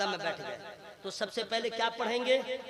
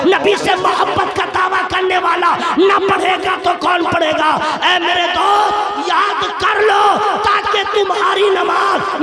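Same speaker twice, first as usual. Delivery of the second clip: shouted, much higher fiery exhortation; preaching at full force; 6 semitones higher, 14 dB louder than usual. Acted. Preacher challenging young men to learn the durood, fiery shout. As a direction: rouse the young men, pleading and urgent.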